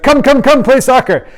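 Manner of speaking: high, loud urging; eager friend. Retold or imitated. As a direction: chant it like an impatient kid. Friend urging him to play.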